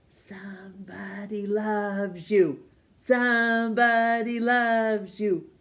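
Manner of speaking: slow breathy whisper building to a sung call. A narrator's reading. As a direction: let the cloth whisper it slowly, then swell. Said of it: "the dust cloth seemed to whisper".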